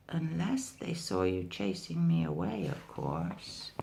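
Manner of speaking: low, soft ominous hint; soft sly warning; dark hint. Acted. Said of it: "said Mouse Deer darkly".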